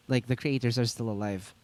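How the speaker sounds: usual speaking voice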